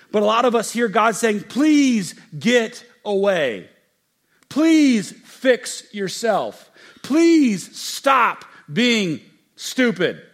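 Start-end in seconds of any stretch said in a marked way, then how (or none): 1.4-10.2 slow, mocking stern voice; drawn-out scornful dismissal; mock harsh God. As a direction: sneer it out as a cold judge. Harsh God people imagine.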